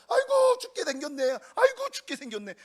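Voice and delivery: high, loud, hammed-up cry of panic; mock panicked wail. Preacher imitating panicking disciples with a hammed-up high wail. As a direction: wail it like a frightened disciple overplaying the fear. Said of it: "호들갑을 막 떠는 거예요".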